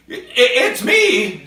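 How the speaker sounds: frightened. Acted. Missus answers the surgeon's call, stammering frightened and high. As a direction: squeak it out in fright. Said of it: "she trembles in every limb".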